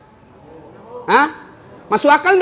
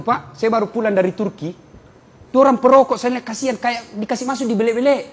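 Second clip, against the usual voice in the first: ordinary delivery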